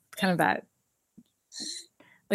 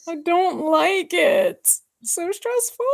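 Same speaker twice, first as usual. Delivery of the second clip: put-on much higher, slow, whiny little kid; small child voice; 9 semitones higher, slower than usual. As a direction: whimper it like a scared kid. Kids hearing Mom and Dad fight.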